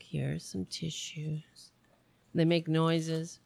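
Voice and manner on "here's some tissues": soft, slow hushed ASMR voice; gentle slow ASMR murmur. Pretend. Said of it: "open up a package very slowly".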